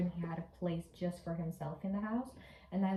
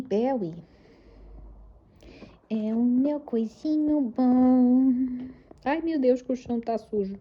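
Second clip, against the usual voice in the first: high, loud cooing to the cat; high baby talk; 5 semitones higher, 9 dB louder than usual. Acted.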